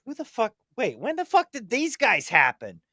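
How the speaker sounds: lightly voiced alien double-take; startled alien. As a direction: catch them off guard. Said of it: "And someone's like".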